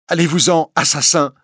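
put-on a young woman terrified of the priest, trembling. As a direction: push him away in fear. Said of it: "dit-elle d'une voix tremblante".

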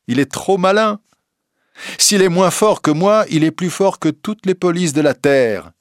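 high, assertive speech; proud young reporter. A narrator's reading. Simple journalist tells the court he is no policeman, proud. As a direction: declare it with proud conviction. Rouletabille defends himself proudly.

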